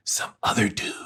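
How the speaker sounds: whispered impersonation; near-whisper, trailing away; hushed reply. Retold or imitated. Acts out Pauly Shore's sly reply.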